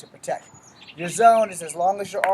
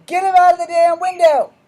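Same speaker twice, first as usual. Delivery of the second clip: furious yell; 12 semitones higher, 13 dB louder than usual. Real, not acted. Yelling at the kid outside.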